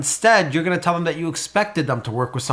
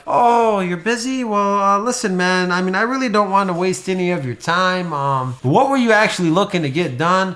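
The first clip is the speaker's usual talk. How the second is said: higher, wavering, unsure rep; hesitant rambling; 3 semitones higher than usual. Shown as a demonstration. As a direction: ramble like you're afraid of wasting their time. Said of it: "If I say it like that compared to like".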